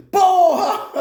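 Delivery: high, loud comic curse; mock outburst. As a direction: burst out as an exasperated Jesus. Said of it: "Jesus começava a esbravejar". (esbravejando de forma cômica)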